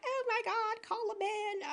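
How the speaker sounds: high, soft, helpless plea; mock damsel panic. Woman cracking under pressure at a roach, mock panic. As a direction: play the feeble damsel for laughs.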